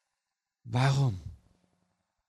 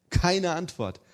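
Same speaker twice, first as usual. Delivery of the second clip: louder and higher repeat; emphatic; 7 semitones higher, 6 dB louder, slower than usual. Shown as a demonstration. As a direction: hit it harder the second time. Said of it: "repeated phrase, second take louder".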